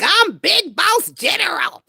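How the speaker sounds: high, puffed-up mock bragging voice; mocking Tia's boast. Mocking Tia announcing herself to Susie, high mock boast. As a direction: ham up her self-important title. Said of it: "And Tia was like".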